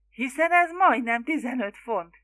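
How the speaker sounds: aghast protest. A narrator's reading. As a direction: balk at it, appalled. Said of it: "hüledezett Megtevis". (hüledező, felháborodott tiltakozás)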